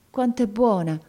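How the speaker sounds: plain reading voice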